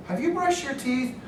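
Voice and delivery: high, pointed parent question; nagging parent. Retold or imitated. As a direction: needle her about the teeth. Parent nagging daughter in car.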